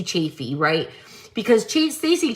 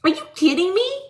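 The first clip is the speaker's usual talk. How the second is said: usual speaking voice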